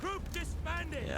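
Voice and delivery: a quick jokey stab at a Hobbit voice; Hobbit accent. Pretend. Gamer joking about second breakfast, badly faked Hobbit accent. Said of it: "I don't do a good Hobbit accent".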